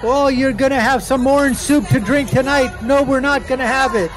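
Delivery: loud, high mocking protester voice; mocking protester voice-over. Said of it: "I'm gonna voice over this thing here".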